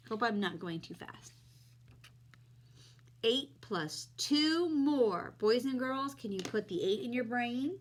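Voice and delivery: put-on friendly teacher talking to kids; kids show host. Teaching kids addition on camera.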